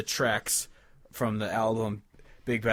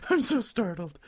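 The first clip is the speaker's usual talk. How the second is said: higher, hammed-up startled cry; mock startled; 8 semitones higher than usual. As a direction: play being startled, overdone on the repeat. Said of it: "jokey filler while friend runs".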